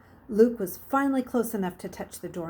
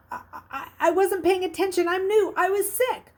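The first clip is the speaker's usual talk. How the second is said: put-on high, louder, stammering sick boy; acting dumb and queasy; 11 semitones higher, 6 dB louder than usual. A narrator's reading. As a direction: play dumb and a bit sick to fool him. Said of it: "tried to act dumb and queasy".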